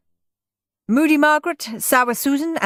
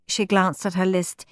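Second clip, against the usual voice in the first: usual speaking voice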